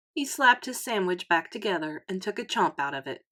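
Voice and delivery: ordinary delivery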